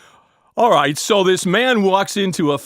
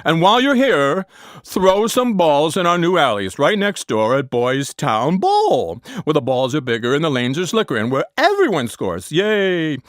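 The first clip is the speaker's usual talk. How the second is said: ad pitch; 3 semitones higher than usual. Acted. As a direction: sell the alley, pumped. Ad pitch for bowling alley.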